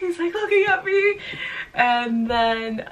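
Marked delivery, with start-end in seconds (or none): 0.0-1.2 laugh-crying. Real, not acted.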